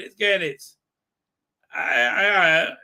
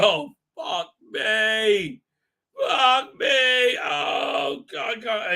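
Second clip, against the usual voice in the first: mock panic; 5 semitones higher, 3 dB louder, much slower than usual. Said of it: "he's like".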